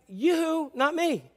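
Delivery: high-pitched playful objection; mock protest. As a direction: call out a comic "count me out". Mary's objection acted out in sermon.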